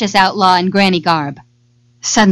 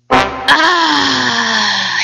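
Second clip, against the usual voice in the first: long sigh sliding downward; satisfied sigh; 4 semitones higher than usual. A narrator's reading. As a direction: let out a drawn-out, sated "ah". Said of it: "he sighed".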